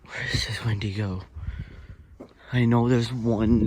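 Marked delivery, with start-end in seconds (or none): 0.0-1.2 hushed scared whisper. Real, not acted. Vlogger in the dark woods, whispering in fear. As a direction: breathe it out, afraid to be heard.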